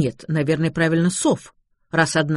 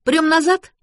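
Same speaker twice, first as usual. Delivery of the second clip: high, louder eager question; fussing girl ready to push; bustling eagerness; 12 semitones higher, 5 dB louder than usual. A narrator's reading. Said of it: "засуетилась Шарлотта".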